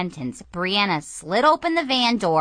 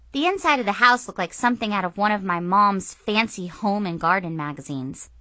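usual speaking voice

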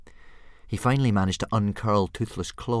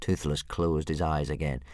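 ordinary delivery

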